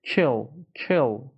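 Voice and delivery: "Kill" with a short, lax vowel, near kel; teacher's model of "Kill"; correct short vowel. Shown as a demonstration. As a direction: relax the vowel of "Kill" towards EH.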